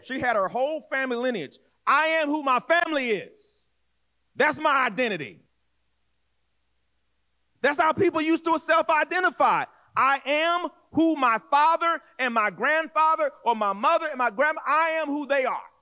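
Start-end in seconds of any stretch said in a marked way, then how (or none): 1.9-5.4 proud declaration. Acted. Preacher voices Rebecca's proud declaration. 10.0-15.7 proud declaration. Acted. Preacher voices Rebecca's proud declaration.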